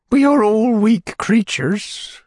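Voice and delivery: high, slow fluttery widow; fussy widow voice. A narrator's reading. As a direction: sigh it out like a delicate widow. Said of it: "Widow Corney's voice".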